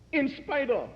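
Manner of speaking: soaring, impassioned preacher voice; preaching at full force. Acted. Preacher to congregation, building to a fervent climax. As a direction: lift it up and drive the point home. Rising climax of love sermon.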